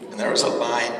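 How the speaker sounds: ordinary talk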